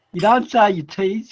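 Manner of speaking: ordinary talk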